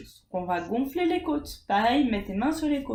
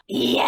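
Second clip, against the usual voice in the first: scream; 5 dB louder than usual. Shown as a demonstration. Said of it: "nos premiers screams".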